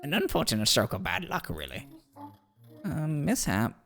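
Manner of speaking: pompous, musing lecturer with swooping pitch; swooping, measured know-it-all; wordy theorizing character. Acted. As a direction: muse aloud like a self-assured scholar, then instruct. Voices a game character.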